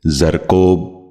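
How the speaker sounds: زرکوب ending in long o, "zar-kobe"; correct زرکوب. Shown as a demonstration. Teacher modelling for viewers, زرکوب said as "zarkobe". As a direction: open the last vowel of زرکوب to o, not oo. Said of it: "آپ بھی سنیے".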